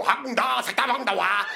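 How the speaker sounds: hammed-up fake Chinese chatter; mock Chinese babble. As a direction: fake Chinese syllables in a different voice. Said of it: "imagine you just go".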